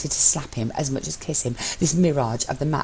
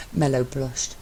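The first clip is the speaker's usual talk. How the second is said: ordinary delivery, nothing marked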